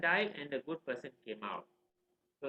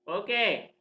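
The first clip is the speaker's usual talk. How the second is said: loud, raised okay; exasperated resolve; 5 semitones higher, 8 dB louder than usual. Retold or imitated. Upset father resolving to try again, forceful. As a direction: snap into a new plan.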